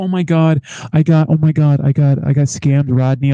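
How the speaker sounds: loud panicked cry of being scammed. Retold or imitated. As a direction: retell her loud frantic panic. Panicked manager retold.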